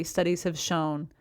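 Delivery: normal talking voice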